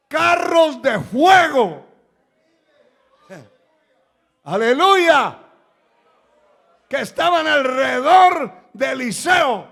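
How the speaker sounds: high, loud proclamation; full-force preaching. Acted. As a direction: cry out the wonder to the congregation.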